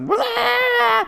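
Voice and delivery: high, loud death-rock scream; metal scream. Retold or imitated. Emo kid swinging at his friend, screaming metal. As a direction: let out a mock heavy metal screech. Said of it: "start screaming like this heavy metal punk death rock".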